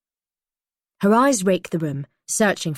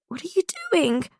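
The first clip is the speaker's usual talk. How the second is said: high, anxious cry; alarmed question; 13 semitones higher than usual. A narrator's reading. Prisoner watches Holly hide, alarmed. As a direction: ask it in rising panic.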